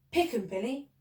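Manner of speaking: usual speaking voice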